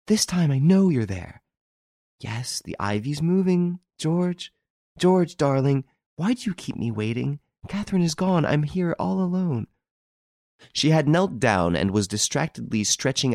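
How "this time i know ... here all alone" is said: high, thrilled lover's voice; yearning love. A narrator's reading. Girl calling to her hidden lover in the garden, yearning. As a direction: plead sweetly in a raised pitch. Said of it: "the thrill of her voice, her whole being expressed desire and love".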